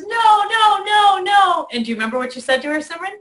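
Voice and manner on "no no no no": high, loud tearful no's; upset child refusing. Retold or imitated. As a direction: push everyone away mid-meltdown. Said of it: "And Keisha says".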